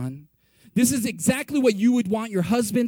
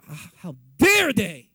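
slow, loud, lower indignant gasp; mock outrage; 4 semitones lower, 6 dB louder than usual. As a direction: play the scandalised gossip, drawn out. Mocking the outraged friend.

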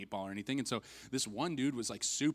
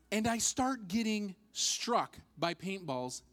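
ordinary delivery

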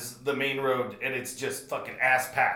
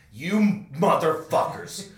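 loud, raised, slowed furious curse; furious yell; 4 semitones higher, 5 dB louder than usual. Retold or imitated. Raging at callers he thinks faked his dog's death, furious yell. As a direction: spit it out in a rage, drawn out.

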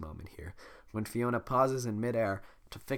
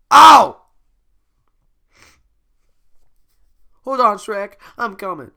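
eager shout; 9 semitones higher, 20 dB louder, slower than usual. Acted. Donkey calling out.

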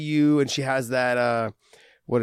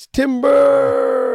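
drawn-out, high lumberjack call; mock warning shout, stretched right out; calling timber; 13 semitones higher, 10 dB louder than usual. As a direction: holler it long and loud like a tree is falling. Imitating a falling tree.

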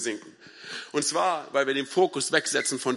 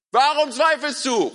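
drawn-out high, loud shout; 8 semitones higher, 6 dB louder, slower than usual. Acted. Jesus calling to Petrus in wind and waves, shouting. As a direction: yell the question slowly through the storm. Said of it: "Er muss geschrien haben".

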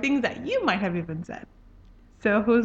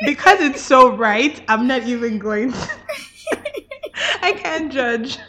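laughing; 5 semitones higher, 5 dB louder than usual. Real, not acted. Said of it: "host cracking up".

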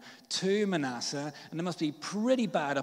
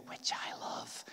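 quick whispered confession; whispered aside; 9 dB quieter, slower than usual. Real, not acted. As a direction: whisper it confidentially.

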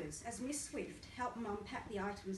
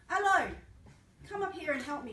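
loud, higher call for help; mum shouting; 7 semitones higher, 11 dB louder than usual. Retold or imitated. Mum calling her son to the front to help, loud. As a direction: summon the boy up front. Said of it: "she shouted".

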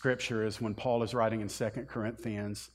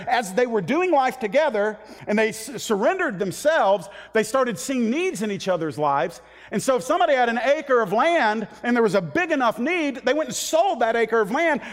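louder, higher, driving sermon delivery; full-force preaching; 9 semitones higher, 7 dB louder than usual. Acted. Pastor drives the point home to his congregation, preaching at full force. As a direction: preach it out to the whole room.

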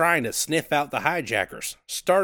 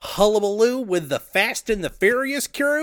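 trucker on CB radio; 8 semitones higher than usual. Acted. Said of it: "I will be Rubber Duck".

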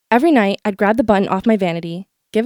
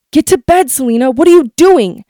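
louder, higher startled dad; dad yelling; 4 semitones higher, 6 dB louder than usual. Retold or imitated. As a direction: snap at her to get to bed.

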